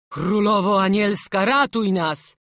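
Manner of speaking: a hoarse, pleading prayer; hoarse cry. A narrator's reading. Master prays as the boy climbs, hoarse cry. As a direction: call out in a hoarse voice. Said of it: "Wołał stwórz ochrypłym głosem".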